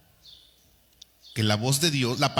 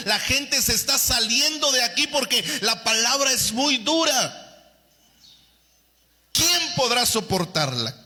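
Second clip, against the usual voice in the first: higher, rising insistent complaint; building protest; 7 semitones higher than usual. Retold or imitated. Apostles telling the Lord people are leaving, building protest. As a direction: press the complaint harder each line.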